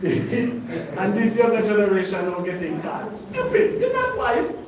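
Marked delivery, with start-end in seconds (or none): 3.2-4.6 much higher, louder scolding sermon; rising preacher's rebuke, loud and high; fiery preaching. Acted. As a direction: scold the young generation from the pulpit. Preaching at full force.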